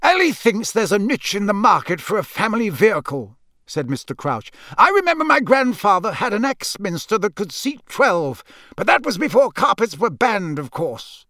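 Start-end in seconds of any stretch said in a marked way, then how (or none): 0.0-3.4 high, precise official voice; officious bureaucrat. A narrator's reading. Senior official discussing the carpet market, officious. Narrator plays Mister Crouch. 4.8-11.3 high, officious, insistent; emphatically law-abiding. A narrator's reading. Official insisting his ancestors obeyed the law, emphatic. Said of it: "spoke as though he wanted to leave nobody in any doubt".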